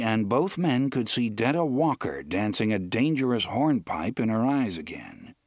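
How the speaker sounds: plain reading voice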